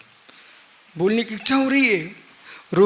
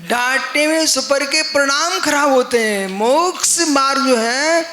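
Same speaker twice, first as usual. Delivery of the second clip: raised, higher preaching voice; emphatic admonition; 5 semitones higher, 4 dB louder than usual. Real, not acted. Preacher tells fathers not to scold, emphatic admonition. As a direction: press it home loud and high.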